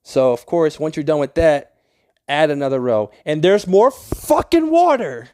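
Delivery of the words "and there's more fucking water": louder, higher, slow, fed-up cry; real annoyance bursting out; furious at the water again; exasperated yell. Real, not acted. Water keeps flooding the build.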